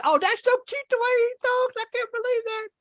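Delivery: put-on delighted grandparents gushing over a toddler's accent; doting grandparents. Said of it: "they thought it was".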